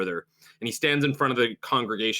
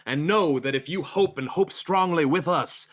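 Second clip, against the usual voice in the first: raised, emphatic sermon voice; consoling preacher; 3 semitones higher, 3 dB louder than usual. Acted. Preacher answering a nostalgic follower, fervently assuring. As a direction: promise it like a preacher to his flock.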